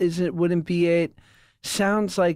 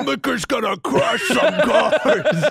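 monster truck announcer; 5 semitones higher, 4 dB louder than usual. Pretend.

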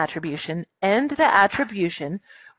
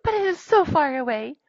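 high-pitched wistful storybook line; Little Mermaid; 8 semitones higher than usual. Acted. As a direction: voice a longing character, pitched up. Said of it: "sighed the Little Mermaid".

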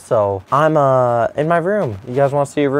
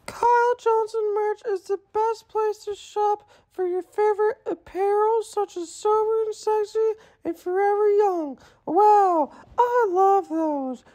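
much higher, soft squeaky pitch; 8 dB quieter than usual. Shown as a demonstration. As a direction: squeak it out like a cartoon. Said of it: "say it again, like, actually".

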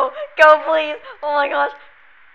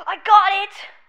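gasping, high and loud cheer; a burst of real triumph as the dart lands; excited gasp; 9 semitones higher, 8 dB louder than usual. Real, not acted. Gamer cheering a perfect hit, excited and loud. Gasps at hitting the target.

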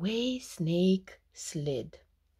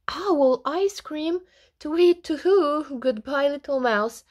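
high-pitched owl in a fluster; startled, raised voice, hurrying to take leave; alarmed owl; 7 semitones higher, 5 dB louder than usual. A narrator's reading. Owl to mouse on hearing the menu, alarmed.

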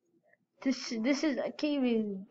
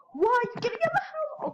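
alarmed surprise; 12 semitones higher than usual. Acted. Friend flees the house.

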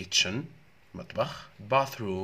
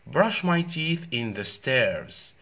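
slow, loud and deliberate reading; slow teacher read; slow model sentence; 3 semitones higher, 5 dB louder, much slower than usual. Shown as a demonstration. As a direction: read it slowly for the class.

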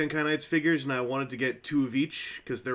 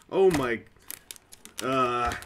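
ordinary talk

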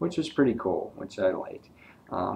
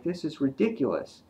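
imitating exasperated dad; 7 semitones higher than usual. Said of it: "he's like".

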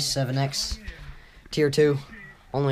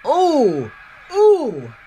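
rising excited oohs, higher and louder; excited gasps; 9 semitones higher, 6 dB louder, much slower than usual. Real, not acted. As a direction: gasp in high excitement. Watching a game clip excitedly.

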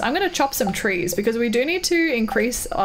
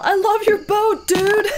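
high, loud excited yell at a stranger; mock yell to a stranger about his boat; 9 semitones higher, 4 dB louder, slower than usual. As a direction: shout it across the dock like a fan. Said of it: "I'm tempted to yell".

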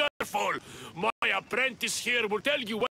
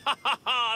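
a short booming laugh; hearty laugh; 6 semitones higher, 4 dB louder than usual. Acted. Blacksmith laughs.

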